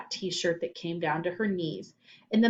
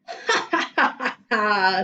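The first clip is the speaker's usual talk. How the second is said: evil laugh; 2 semitones higher, 6 dB louder than usual. Acted. Potato salad witch gloating at the boys, cackling laugh. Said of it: "The potato salad laughed".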